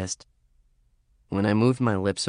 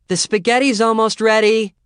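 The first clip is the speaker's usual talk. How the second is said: calling out; 12 semitones higher, 8 dB louder than usual. Acted. Calling from the kitchen.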